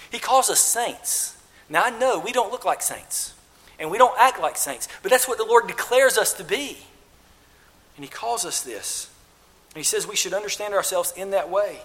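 normal talking voice

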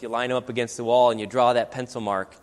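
normal talking voice

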